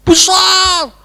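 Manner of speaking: high, loud, drawn-out word; shouted emphasis. Real, not acted. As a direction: blow the word up huge. Preacher blows up one word. (teriakan penekanan, tinggi dan panjang)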